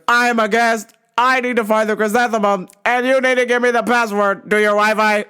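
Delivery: mock deaf man's over-projected monotone; yelling deaf customer. Deaf customer demands password as guest, imitated yelling. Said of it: "Just yelling, man".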